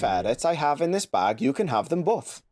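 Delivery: sing-song mock Welsh, swooping up and down; Welsh accent. Shown as a demonstration. Telling the ferret joke, sing-song Welsh accent. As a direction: Welsh lilt, almost like a song. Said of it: "It's almost like a song".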